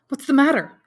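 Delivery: high, loud, urgent ask; alarmed question. Acted. Debray startled by the veiled lady's cry, urgent. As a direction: snap round in sudden concern.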